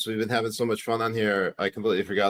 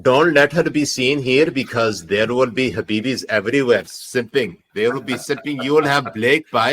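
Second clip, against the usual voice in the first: put-on Punjabi accent; 3 semitones higher, 4 dB louder than usual. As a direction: warn him in a Punjabi accent. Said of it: "when you do your Punjabi accent".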